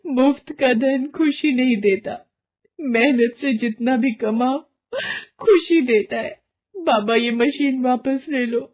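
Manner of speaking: high, tearful sobbing speech; crying mother. Acted. Varsha crying to her husband after losing her son. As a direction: weep over the lost child. Mother weeps, begs the sage.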